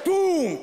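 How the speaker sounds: loud, high, falling boom; booming footstep. Acted. Comic imitates Jon Stewart's mighty steps, big boom. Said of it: "I can hear his accomplishments in each step".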